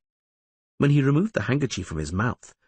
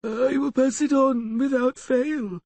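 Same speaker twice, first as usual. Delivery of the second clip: higher, squeaky dim-witted servant; squeaky half-wit voice; slower than usual. Acted. The lawyer fakes being a dim butler to strangers, squeaky. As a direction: play the fool in a thin squeak. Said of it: "playing the role of a simple lackey".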